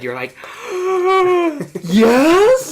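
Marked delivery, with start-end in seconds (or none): 0.4-1.8 sharp high gasp of delight; a wide-eyed starstruck gasp; excited gasp. Retold or imitated. Friend mimicking her starstruck reaction with a loud gasp. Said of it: "your eyes go all wide". 1.8-2.7 squealed yes, much higher and louder; disbelieving thrilled squeak; starstruck yes. Retold or imitated. Friend mimicking the fan meeting her idol, squealing. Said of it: "your eyes go all wide".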